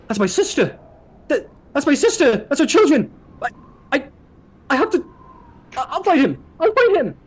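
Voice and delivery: high, loud, desperate cries; frantic warrior. Acted. Warrior sees his sister in danger, frantic shouting. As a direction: stammer and shout as if breaking loose to save family.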